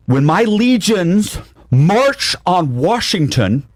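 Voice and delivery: high, soaring, sing-song proclamation of conquest; grandiose madman. Retold or imitated. Inmate lawyer proclaims his march on Washington, grandly sung out. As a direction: crank it up into a delusional emperor's decree. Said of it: "And he says".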